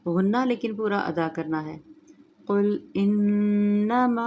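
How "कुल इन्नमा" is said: slow melodic tajweed chant; Quran recitation. Shown as a demonstration. Recites verse after explanation.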